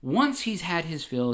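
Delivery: ordinary delivery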